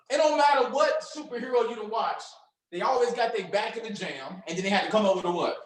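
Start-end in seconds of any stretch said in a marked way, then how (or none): none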